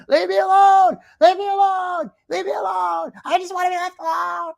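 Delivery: very high mimicked screech, loud at first; mock screaming. Commentator mimicking woman screaming at her housemate, mock screech. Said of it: "You're scre".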